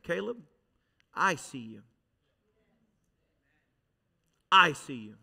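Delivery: low, intimate divine voice; God speaking gently. Retold or imitated. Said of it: "I knew what God was saying".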